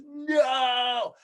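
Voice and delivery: hammed-up anguished cry of "No!"; mock wail. Mocking a woman collapsing at election loss, wailing. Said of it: "she just goes".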